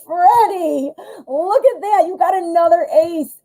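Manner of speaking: thrilled to show the viewer a card; excited. Real, not acted. Reader tells Freddie of another ace, excited high call.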